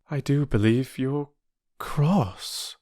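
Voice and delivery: put-on high girl's voice, hushed at the end; a worried girl venturing a guess; timid girl Bobby. Bobby to the sulking porter, timid and hushed.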